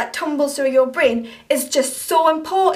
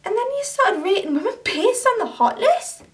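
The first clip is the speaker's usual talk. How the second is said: angry confrontation; 3 semitones higher than usual. Acted. To Mark, for calling her a bitch online: angry and accusing. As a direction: tear into him for what he posted.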